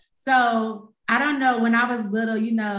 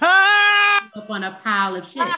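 "pirate ship" slurred into "pile of shit" with a held tongue, louder; tongue sticking out; 8 semitones higher, 7 dB louder than usual. Shown as a demonstration. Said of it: "it sounds like".

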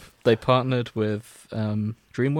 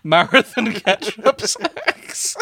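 incredulous; 10 semitones higher, 4 dB louder, much slower than usual. Real, not acted. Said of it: "another strong contender".

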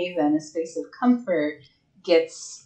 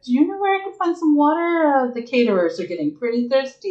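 higher and fast, breezy fake innocence; innocent excuse; 7 semitones higher, 3 dB louder, faster than usual. Player's character bluffing to strangers in the hallway, bright and quick. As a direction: chirp it out like you belong here. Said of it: "I'll be like".

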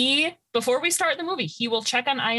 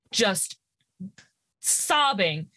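ordinary talk

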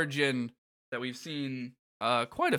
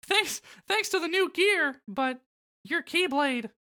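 Mickey Mouse voice. Acted. Voicing Mickey in dialogue.

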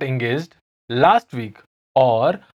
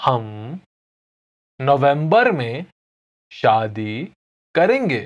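हम, नवंबर में, शादी, करेंगे said slowly with pauses; slow, word by word; faster than usual. Shown as a demonstration. Teacher repeating the answer slowly for learners. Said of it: "I'll say it again slowly".

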